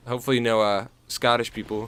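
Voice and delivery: usual speaking voice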